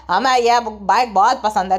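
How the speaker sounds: low-pitched man's voice, mimicked; gutkha king. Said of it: "gutkha king क्या कहता है". (नीची आवाज़ में आदमी की नकल)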